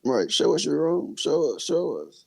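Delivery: mocking whiny troll.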